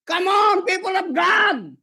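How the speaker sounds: high, loud shouted preaching; shouting at the people of God; shouting preacher. Real, not acted. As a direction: belt it out to the congregation. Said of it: "Napapasigaw na ako dito".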